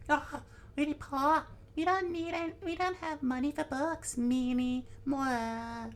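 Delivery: put-on very high, bratty child voice; tiny high voice, defiant; cheeky little girl. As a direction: sass back in a squeaky kid voice. Child Nami talks back to Genzo.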